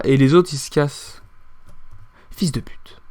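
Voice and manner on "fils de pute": breathless whisper under the breath; whispered curse. Real, not acted. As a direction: hiss the insult to yourself. Insulte chuchotée au jeu. (juron chuchoté)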